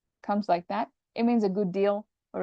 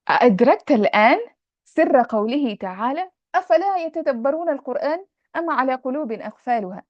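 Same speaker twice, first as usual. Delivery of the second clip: fast, louder, rising declamation; emphatic recitation; 5 semitones higher, 6 dB louder, much faster than usual. Shown as a demonstration. Teacher rereading the student's passage with force. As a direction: read it out as a stirring rhetorical question.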